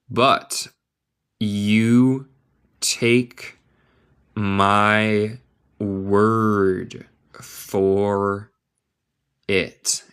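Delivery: very slow, each word set apart; word-by-word model. Shown as a demonstration. Teacher giving learners a new saying, word by word. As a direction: dictate it with long gaps.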